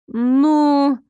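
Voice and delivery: stall, caught off guard; lost-for-words drawl; flustered hesitation. A narrator's reading. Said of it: "Шерон заметно растерялась".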